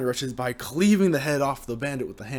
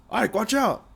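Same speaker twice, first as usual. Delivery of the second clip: much higher urgent warning; warning shout; 9 semitones higher, 3 dB louder, slower than usual. Acted. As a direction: yell the warning.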